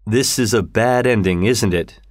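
ordinary delivery

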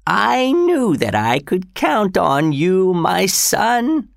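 put-on high, slow dying old man; old father voice. A narrator's reading. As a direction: voice the frail father, rising.

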